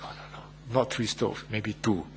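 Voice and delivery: very soft, low relenting murmur; hushed second thoughts of a softening parent. Acted. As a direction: back down under your breath. Parent relents on seeing child's tears.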